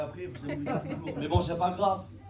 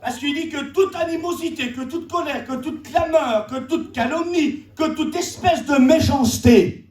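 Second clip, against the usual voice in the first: fervent scripture preaching; 10 semitones higher, 8 dB louder than usual. Real, not acted. Expounds scripture at full force. (prédication solennelle et enflammée)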